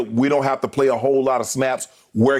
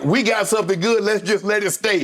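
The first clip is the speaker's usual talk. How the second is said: higher, slowing voice teasing a superstitious friend; teasing Skip in a higher mock superstitious voice; 6 semitones higher than usual. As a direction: ham up his superstitious pleading. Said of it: "you, with your superstitious self".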